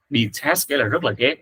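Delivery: ordinary talk, nothing marked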